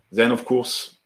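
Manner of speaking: ordinary talk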